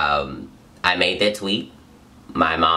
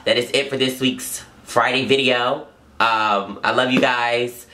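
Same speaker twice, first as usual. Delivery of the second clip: normal talking voice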